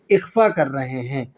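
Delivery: usual speaking voice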